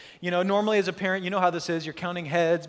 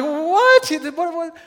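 high, loud "what?"; baffled shout back; 8 semitones higher, 9 dB louder, slower than usual. Acted. Cliff jumper answering a yelling stranger, baffled shout. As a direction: holler back in hammed-up confusion.

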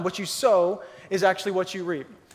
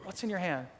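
gentle God; 2 semitones lower, 10 dB quieter than usual. Retold or imitated. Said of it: "He says".

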